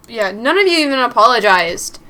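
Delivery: loud, defiant shout; angry shout back. Acted. Mary shouting back at her angry boyfriend. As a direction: throw it back at him.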